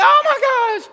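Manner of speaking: loud, very high hammed-up shriek; startled scream. Pastor startled by yelling police, screaming in panic. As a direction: scream it in mock terror. Startled reply to shouting officers.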